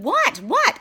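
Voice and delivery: high, loud, indignant cat yowl; grumpy cat voice. Retold or imitated. Imitating her cat Lily's mad-sounding voice with a high, loud squawk. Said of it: "she's got this voice that sounds like she's mad".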